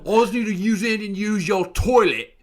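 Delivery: hammed-up drunk lad through laughter; drunk British lad. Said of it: "it's like".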